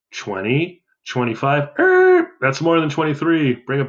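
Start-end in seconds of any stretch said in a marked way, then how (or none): 1.8-2.3 high, loud wrong-answer er; a sudden squeaky error noise; mock buzzer blurt. Real, not acted. Overshoots while counting by fives.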